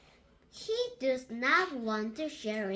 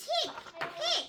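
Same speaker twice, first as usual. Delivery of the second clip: hiccups; 12 semitones higher than usual. Acted. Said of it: "tired and sad".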